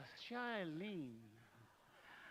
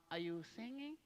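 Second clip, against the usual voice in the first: normal talking voice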